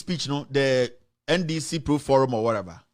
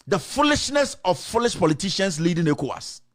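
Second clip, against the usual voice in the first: ordinary talk, nothing marked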